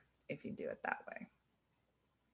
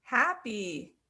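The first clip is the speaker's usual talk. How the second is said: happy; 5 semitones higher than usual. Shown as a demonstration. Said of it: "Happy is half of it".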